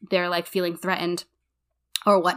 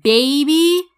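slow, louder, drawn-out announcement; dramatic reveal; 3 semitones higher, 6 dB louder, much slower than usual. Retold or imitated. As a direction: spell out the shock to her friend. Reveals mice in the RV.

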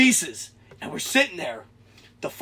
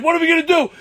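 high, loud retold panic; acted-out alarmed question; freaking out; 10 semitones higher, 5 dB louder, much faster than usual. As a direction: plead helplessly, hammed up. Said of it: "we're freaking out".

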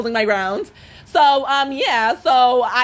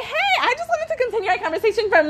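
fake sweet; 9 semitones higher, 3 dB quieter than usual. Her fake-polite greeting at the door.

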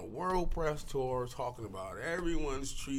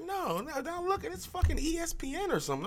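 mock yell at the TV. Yelling at TV screen.

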